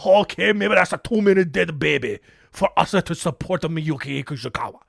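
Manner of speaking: put-on broken English in a light Japanese accent; mock Japanese accent. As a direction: grudgingly give up, lightly accented. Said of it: "when I'm impersonating a Japanese person".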